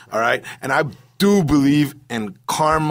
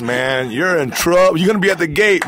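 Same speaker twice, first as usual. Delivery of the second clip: normal talking voice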